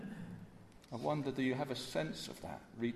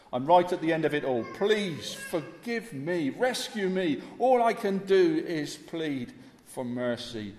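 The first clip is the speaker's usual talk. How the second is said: renewed desperate plea; 3 semitones higher, 8 dB louder than usual. Acted. Preacher as the thief, begging the Lord to save him. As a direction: plead as if it's your final breath. Thief voiced, pleading again.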